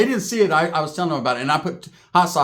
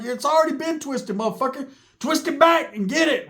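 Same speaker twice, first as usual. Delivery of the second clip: mock high yell; 8 semitones higher than usual. Yelling back at Sharky.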